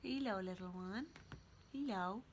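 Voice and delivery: soft cooing to a pet; gentle hushed cat greeting. Real, not acted.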